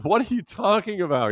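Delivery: high, loud mock confusion; baffled listeners. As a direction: imitate puzzled people. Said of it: "people are like".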